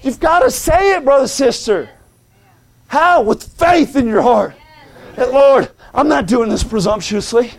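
high, urgent sermon delivery; impassioned pulpit plea; fervent preaching. Acted. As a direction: press the congregation with rising urgency. Preaches with force to congregation.

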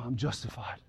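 hushed whisper of relief; whispered inner realisation. Acted. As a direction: breathe it out in awe. Prophet's awed inner realisation.

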